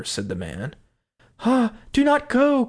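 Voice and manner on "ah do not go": high, frightened cry. Acted. Wife begging husband not to go outside, frightened cry. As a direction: cry out to stop him, much higher. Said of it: "cried the wife".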